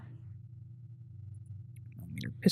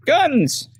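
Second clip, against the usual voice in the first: high, thrilled cry of Guns; a happy high exclamation; excited; 3 dB louder than usual. Real, not acted. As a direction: announce it with delight. Excited heading to gun store.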